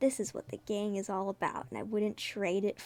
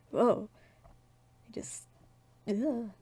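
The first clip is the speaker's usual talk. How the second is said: ordinary talk, nothing marked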